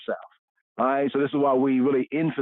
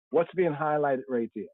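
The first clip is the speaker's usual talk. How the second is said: normal talking voice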